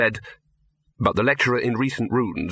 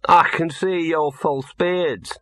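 put-on sniffy young woman; 6 semitones higher, slower than usual. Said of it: "said the girl and sniffed".

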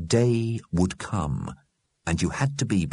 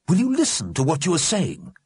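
higher, exasperated character voice; admonishing Mister Grimm; 10 semitones higher than usual. A narrator's reading. Mister Grimm takes the dead to task, admonishing. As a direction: scold them like wayward children.

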